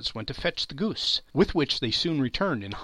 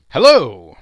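much louder startled cry; sudden exclamation; 16 dB louder than usual. A narrator's reading. Narrator startled by the pudding's arrival, loud sudden cry. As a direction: burst out at the sight of the steam.

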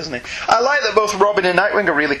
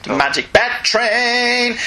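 higher, very slow gleeful echo; excited echo; 7 semitones higher, much slower than usual. Real, not acted. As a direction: savour each word with glee.

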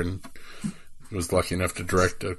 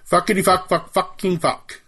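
taunting; 11 semitones higher than usual. Acted. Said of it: "That should give you just about".

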